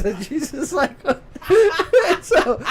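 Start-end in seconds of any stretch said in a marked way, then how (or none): 1.0-2.2 squealing high laugh, loud; high giggle. Real, not acted. Cracking up while telling the partner the story, high squealing laugh. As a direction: burst into a shrieking giggle.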